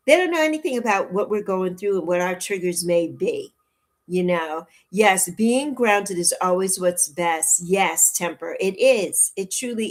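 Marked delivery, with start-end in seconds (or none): none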